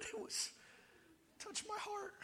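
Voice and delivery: high, very soft weepy voice; hammed-up choked-up whimper; mock tearful. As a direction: play the moved moviegoer, squeaking through fake tears. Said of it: "they're crying".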